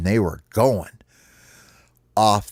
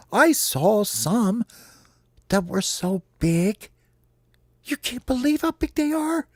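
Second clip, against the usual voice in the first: high squeaky wonder, climbing higher; excited, rising cartoon squeak; awed little critter; 12 semitones higher than usual. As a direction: marvel in a tiny voice, stretching the words. Homie marvels at big round things.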